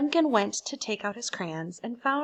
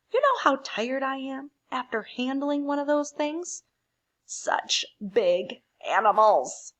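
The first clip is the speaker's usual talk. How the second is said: weary Gray Crayon; 8 dB louder than usual. Acted. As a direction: sigh through the workload, lightly voiced. Teacher voices Gray Crayon's letter.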